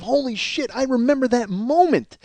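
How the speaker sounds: high-pitched, marveling outburst; amazed recognition. Retold or imitated. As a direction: relive the rush of remembering, pitch soaring. Reliving a visceral memory charge.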